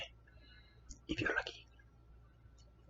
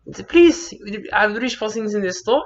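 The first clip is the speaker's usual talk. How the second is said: high, loud mother's voice; animated high-pitched storytelling; mother telling a story; 8 semitones higher, 8 dB louder than usual. Retold or imitated. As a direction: play the mum retelling it.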